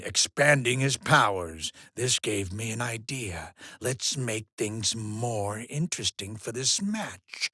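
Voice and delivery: low, hushed musing; soft, sinking plotting tone; scheming. Acted. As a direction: share the idea quietly and very low. Host quietly hatches a scheme.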